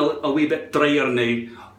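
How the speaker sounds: ordinary talk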